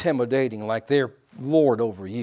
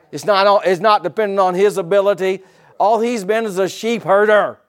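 loud, higher, driving sermon voice; full-force preaching; 8 semitones higher, 11 dB louder than usual. Acted. Preacher stirs his church about David's words, loud preaching. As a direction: preach it out with fire.

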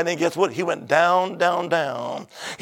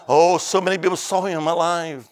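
ordinary talk, nothing marked

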